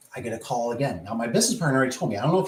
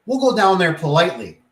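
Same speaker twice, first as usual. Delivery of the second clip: emphatically reasonable; 5 semitones higher, 5 dB louder than usual. Retold or imitated. Proposes a polite retreat to manager.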